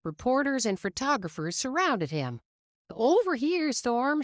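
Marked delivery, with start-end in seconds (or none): 2.9-4.2 calling out. A narrator's reading. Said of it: "shouted a photographer".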